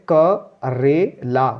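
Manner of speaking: slow, each syllable क रे ला split apart. Shown as a demonstration. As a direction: sound out क रे ला slowly, one syllable at a time. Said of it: "आगे का कबूतर है तो क बोलेंगे पहले". (अक्षर-अक्षर अलग करके धीरे)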